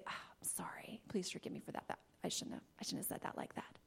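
very soft breathy sorry; whispered apology. Shown as a demonstration. Family member apologizing, very soft whisper. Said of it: "somebody doesn't say".

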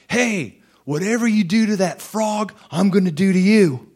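high, loud mocking guard voice; bully him like a sneering guard; harassing guard. Prison guard taunting the inmate over the frog, high and mocking.

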